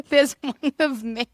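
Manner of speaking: high, loud, through laughter; laughing. Real, not acted. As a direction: blurt it out while laughing. Laughs at own answer.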